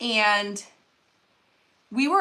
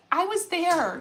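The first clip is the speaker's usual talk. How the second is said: higher, voice full of feeling; emotional retold reply; 6 semitones higher than usual. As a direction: retell God's tender answer while holding back emotion. God's reply, teller getting emotional.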